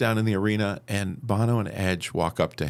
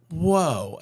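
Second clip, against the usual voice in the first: amazed; 8 semitones higher than usual. Retold or imitated.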